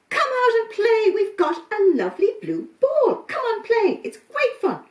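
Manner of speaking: high, loud bouncy rabbit voices; cheery rabbits. Acted. Rabbits invite the goat kids out to play, high and cheery. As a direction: coax them out to play.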